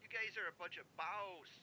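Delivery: very soft, tentative Cockney attempt; practicing Cockney accent. Shown as a demonstration. Caller practicing before trying again, hushed Cockney accent. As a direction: rehearse Cockney under your breath. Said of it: "I'm gonna practice".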